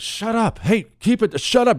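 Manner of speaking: high, hissed shut up; mock hushing recruits. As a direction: shush him up high, irritated. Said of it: "we're all just like".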